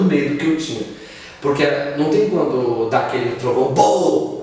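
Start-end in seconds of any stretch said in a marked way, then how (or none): 3.7-4.4 loud, high booming thunder imitation; imitated thunder roar; thunderclap boom. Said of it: "A voz era desse jeito".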